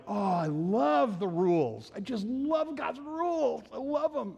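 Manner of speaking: high, slow, swooning over the rules; mock gushing. Preacher mimics an odd rule-lover, mock gushing. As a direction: gush about God's rules as if in love. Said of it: "we don't normally talk like that".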